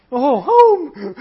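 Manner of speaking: high, loud burst of relief; mock joyful shout at hearing English; relieved cry. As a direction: cry out like finally hearing home.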